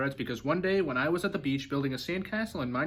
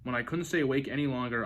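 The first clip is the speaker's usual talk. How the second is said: plain reading voice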